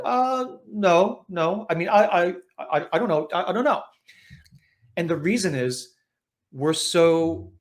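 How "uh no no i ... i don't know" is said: higher, stammering, caught off guard; mock nervous stammer, voice pitched up; flustered Gary. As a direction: play a flustered, dodging Gary. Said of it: "Gary will be like".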